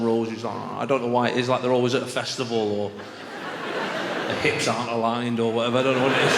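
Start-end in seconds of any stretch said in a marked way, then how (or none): none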